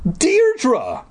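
high call across the room. Acted. Narrator calling out to Deirdre in alarm. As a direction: call her name in alarm.

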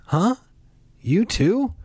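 high, startled huh; surprised. A narrator's reading. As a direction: jump up in surprise. Surprised reply.

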